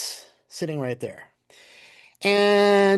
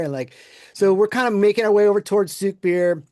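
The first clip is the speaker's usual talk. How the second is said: normal talking voice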